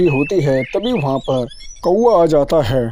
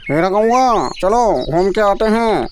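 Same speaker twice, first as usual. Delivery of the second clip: parrot calling out; 5 semitones higher than usual. Acted. Parrot calling his friend the crow out for a walk, high and bright. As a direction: sing out to the crow from afar. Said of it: "तोता आवाज मारता है".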